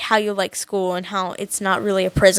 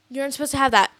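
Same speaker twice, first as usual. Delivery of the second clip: usual speaking voice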